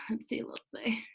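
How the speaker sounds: a quick whisper to herself while scanning questions; whispered aside. Real, not acted. Coach whispering to herself while she picks the next question. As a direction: breathe it out under her breath, no voice.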